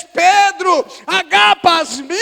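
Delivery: loud, high cry, stretched and rising; impassioned questioning. Acted. Jesus asks Peter the second time, impassioned shout. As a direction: call out the question at full voice.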